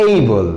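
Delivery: এইবল with an ay sound, falling firmly; এইবল, দৃঢ়ভাবে সঠিক উচ্চারণ; right way. Shown as a demonstration. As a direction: settle it: এইবল with a clear ay.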